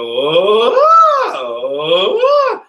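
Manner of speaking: rising roller coaster oh; roller coaster whoop. Shown as a demonstration. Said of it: "pretend like we're on a roller coaster".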